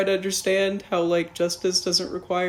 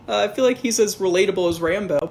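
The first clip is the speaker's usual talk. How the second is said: usual speaking voice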